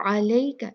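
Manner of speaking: अलैका with a soft glided ai; soft leen. Shown as a demonstration. Teacher showing leen on अलैका. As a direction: glide ai in अलैका without a hard y. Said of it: "लीन है".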